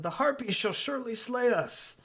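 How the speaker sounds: high terrified townsperson; fearful wail. Acted. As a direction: moan in dread, voice pitched high. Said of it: "some are yelling things like".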